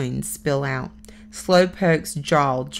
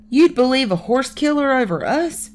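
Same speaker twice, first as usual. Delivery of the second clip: high, loud indignant bluster; boy blustering at the rum runner; 8 semitones higher, 5 dB louder than usual. Acted. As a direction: puff up and protest. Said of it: "Beans blusters".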